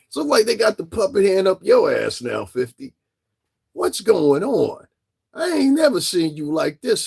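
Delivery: high mocking voice, teasing him; mocking taunt. Real, not acted. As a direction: needle him like a grinning rival. Mocking [name] directly.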